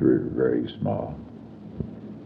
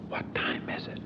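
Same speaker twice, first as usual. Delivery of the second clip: hushed whisper in a funeral pew; 16 dB quieter than usual. Retold or imitated. Leaning to Howard in the chapel, whispered question. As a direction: whisper it so nobody hears.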